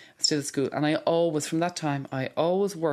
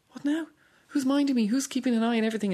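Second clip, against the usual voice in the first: much higher, worried rush of questions; anxious inner voice; 8 semitones higher than usual. Retold or imitated. Patient recalling her fears after hospital discharge, voiced higher and anxious. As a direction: voice the panic after treatment ends. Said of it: "And you're going".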